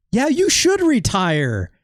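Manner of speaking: hammed-up sensible friend; mock encouragement. Player mocking their own dialogue choice to the wrestler. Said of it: "I'm here being like".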